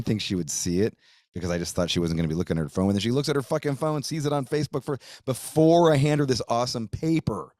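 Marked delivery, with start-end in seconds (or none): 2.9-7.5 higher, faster exasperated rant. Real, not acted. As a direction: vent the annoyance, pitched up and quick. Annoyed about her seeing it early.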